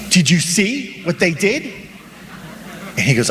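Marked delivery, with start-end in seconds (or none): 0.1-1.7 higher, drawn-out indignant question; mock indignation. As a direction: act out a slow, incredulous complaint. Acts driver cut off in traffic.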